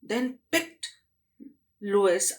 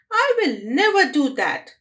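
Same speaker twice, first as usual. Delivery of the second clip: put-on loud, higher stubborn girl; defiant refusal; 6 semitones higher, 6 dB louder than usual.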